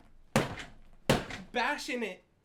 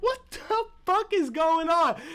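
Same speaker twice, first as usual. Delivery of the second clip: loud, high, slow, stunned cry; shocked yell; 11 semitones higher, 8 dB louder, slower than usual. Retold or imitated. The audience reacts to the sudden suicide, a loud, high, slow shocked cry. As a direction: blurt it out in shock.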